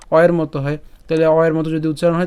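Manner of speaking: ordinary talk, nothing marked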